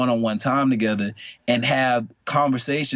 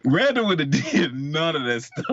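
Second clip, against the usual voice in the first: higher, laughing disbelief; 5 semitones higher than usual. Real, not acted. Telling the cohost his old self wouldn't do it, laughing. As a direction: laugh at how unlike him it was.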